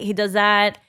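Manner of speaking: ordinary delivery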